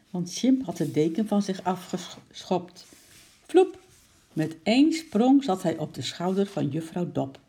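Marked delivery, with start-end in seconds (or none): 3.5-3.8 high, loud "vloep"; a sudden pitched-up swoop sound; whoosh sound effect. A narrator's reading. Narrator imitates monkey's sudden leap. (hoog, plots geluidseffect)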